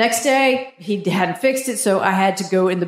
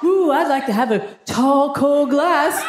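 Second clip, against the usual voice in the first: slow, higher, hammed-up craving voice; hammed-up sultry ad voice, a bit higher; mock sultry ad; 4 semitones higher, slower than usual. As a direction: purr it like a cheesy commercial. Mock ad for nut juice.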